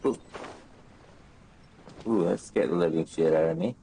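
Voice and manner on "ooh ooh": usual speaking voice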